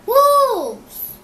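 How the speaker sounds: high, loud scared cry; frightened cry. A narrator's reading. Frightened dog crying out at real wolves, high and loud. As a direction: cry out in fear. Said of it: "he cried".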